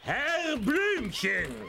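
high, drawn-out shouted call; shouting. Acted. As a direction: stretch the name out in a call. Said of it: "Sie müssen jetzt nicht mehr so schreien".

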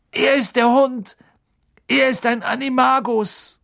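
put-on high, loud strained young voice; pained urgent warning. A narrator's reading. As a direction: warn him through the pain. Ron in pain warns Harry. (gequälte, dringende Warnung)

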